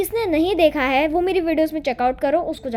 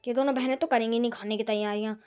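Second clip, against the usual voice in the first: lower, softer grumbling aside; lower, soft complaining aside to herself; muttering to herself; 5 semitones lower, 7 dB quieter than usual. Acted. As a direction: grumble under your breath alone. Bahu grumbles to herself alone.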